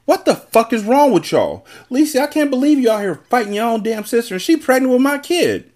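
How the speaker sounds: high-pitched, indignant scolding; angry yelling. A narrator's reading. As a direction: rail at them in high, strained disbelief. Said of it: "Daylo yelled".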